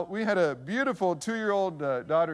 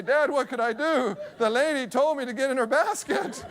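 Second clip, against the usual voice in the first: higher-pitched imitation of a boy; protesting son; 7 semitones higher than usual. Son in the basket to Dad, higher-pitched protest. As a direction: plead innocence as a boy would, pitched up.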